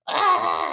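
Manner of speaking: high, loud overwhelmed "ah"; short burst of pretend panic; mock scream. Business owner recalling pandemic then inflation hitting, mock scream. Imitating being overwhelmed by events.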